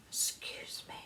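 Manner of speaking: imitating a polite whispered warning. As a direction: whisper discreetly to a stranger. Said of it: "She's gone".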